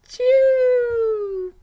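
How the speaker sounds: loud, high, drawn-out acted sneeze; storybook sneeze at full volume; huge mock sneeze. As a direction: explode into a long, squealed achoo. Acts out the bear's sneeze.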